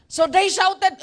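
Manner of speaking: sudden loud burst; preacher shouting as prophets shout, loud. Acted.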